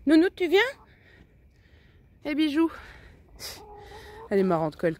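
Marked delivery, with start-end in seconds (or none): 0.1-2.7 appel aigu et fort, très câlin; voix aiguë affectueuse. Real, not acted. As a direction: interpeller la poule comme un bébé. Calls her hen. (voix de gâtifiage aiguë et forte)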